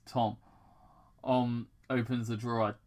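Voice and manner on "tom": ordinary delivery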